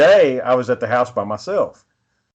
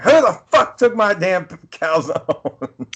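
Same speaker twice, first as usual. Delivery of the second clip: mock angry mom; 7 semitones higher, slower than usual. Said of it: "she's like".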